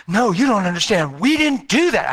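high, loud insisting; emphatic insistence. Retold or imitated. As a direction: stress that they didn't do it. Doctor insists again, emotional.

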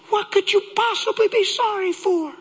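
high, pleading incredulous question; anguished disbelief. Retold or imitated. Husband to apologizing wife, anguished disbelief.

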